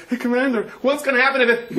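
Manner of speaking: high, loud, frightened question; panicked soldier. Acted. Soldier calling to his commander under fire, high and panicked. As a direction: shout it scared under fire.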